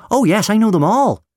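put-on high, loud, excited child; eager little boy. A narrator's reading. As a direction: burst out like a proud young fan. Boy proudly shows his knowledge.

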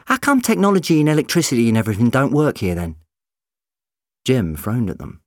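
fast and emphatic; hurried. A narrator's reading. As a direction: rush in to cover a slip. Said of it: "Rose said quickly".

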